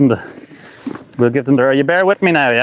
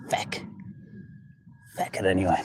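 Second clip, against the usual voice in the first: much softer, lower feck; under-breath curse; 8 semitones lower, 14 dB quieter than usual. Real, not acted. Annoyed at the windy mic, cursing quietly. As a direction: grumble it to yourself.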